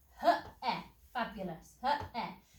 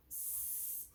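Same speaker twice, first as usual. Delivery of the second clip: whispered letters; 21 dB quieter than usual. Shown as a demonstration. Teacher sounding out letters.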